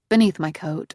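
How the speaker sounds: plain reading voice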